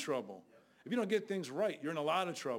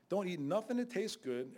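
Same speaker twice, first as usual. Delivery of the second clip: ordinary talk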